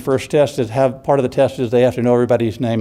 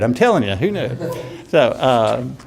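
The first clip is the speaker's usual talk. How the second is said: usual speaking voice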